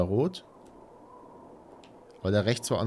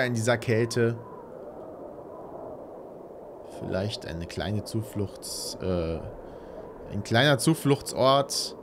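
brooding horror narration; 3 semitones lower, 5 dB quieter than usual. Acted. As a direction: murmur it like a grim narrator. Dramatic narration of horror game.

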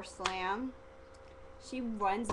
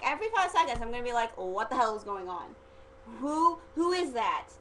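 higher, louder mock-baffled questions; hammed-up confusion, pitched up and insistent; acting clueless; 5 semitones higher, 5 dB louder than usual. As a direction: pester with bewildered questions. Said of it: "I told her, I'm like".

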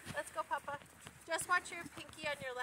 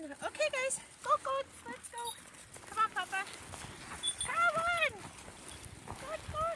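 higher, sing-song animal call; 7 semitones higher than usual. Real, not acted. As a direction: coax the goats along cheerfully. Calling goats to come along.

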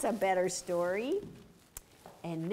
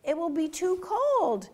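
urgent fox; 8 semitones higher than usual. Acted. Fox warns rabbits.

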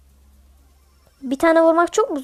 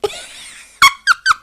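a very high, loud put-on cackle; over-the-top witch cackle; 8 dB louder than usual. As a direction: shriek out a witch's laugh. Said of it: "Aslında böyle gülmem".

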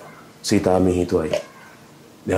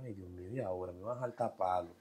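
retold crying; 3 semitones lower, 13 dB quieter than usual. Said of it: "ya yo estaba llorando".

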